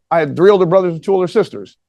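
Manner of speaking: ordinary delivery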